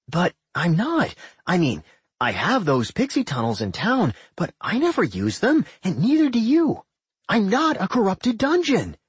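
high, whiny complaint; a petulant little dungeon; childish dungeon voice. A narrator's reading. Doc voiced, defensive.

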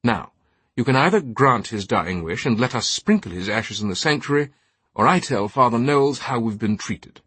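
highbrow British ultimatum, indignant; haughty British knight, threatening. Acted. Knight threatening to report the altar boy, haughty indignation. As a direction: lay down the choice like an affronted aristocrat.

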